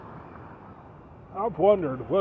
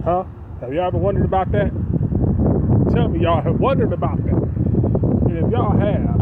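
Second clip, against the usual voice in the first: loud insistent; 8 dB louder than usual. Acted. Preacher pressing the audience.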